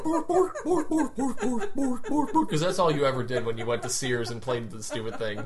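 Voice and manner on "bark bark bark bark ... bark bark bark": high loud mock barks, laughing; imitating barking piano. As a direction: bark like the toy keyboard. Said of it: "it's not the one where you bark".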